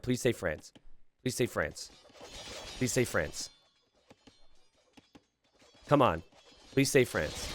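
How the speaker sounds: hushed pleading. Real, not acted. Commentator hoping the player says France, hushed plea. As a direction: quietly beg for it.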